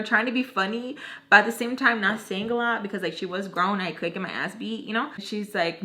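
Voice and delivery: ordinary talk, nothing marked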